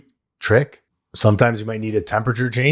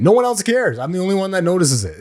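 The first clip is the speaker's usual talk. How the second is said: amused disbelief; 9 semitones higher than usual. Real, not acted. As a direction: voice jumps high, quick and incredulous. Mock outrage about train rider.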